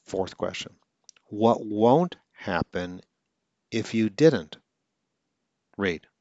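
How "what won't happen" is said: ordinary talk, nothing marked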